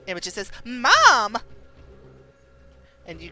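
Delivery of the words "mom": loud high cry; cry for mom. Acted. Daughter crying out to her mother, loud. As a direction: call out in alarm.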